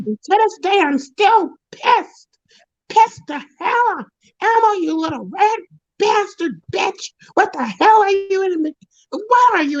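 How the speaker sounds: high, crying shouted rant; still furious. Real, not acted. Ranting at Elmo over the lost role, high crying fury. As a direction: rage at Elmo, voice breaking high. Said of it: "I was so angry".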